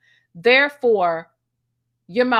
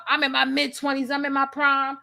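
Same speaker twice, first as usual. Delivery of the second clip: normal talking voice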